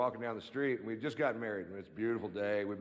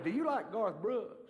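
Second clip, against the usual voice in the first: fan voice; 9 semitones higher than usual. Retold or imitated. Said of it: "He goes".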